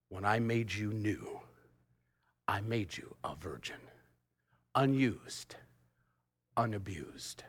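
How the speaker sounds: hushed, spaced-out phrases; gentle divine answer. Acted. God answering the ashamed young man, hushed and deliberate. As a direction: say it softly, pausing between phrases.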